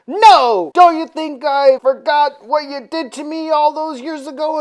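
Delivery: high, loud indignant outburst as Dracula; mock indignant. Dracula confronting the doctor over old wrongs, mock indignant.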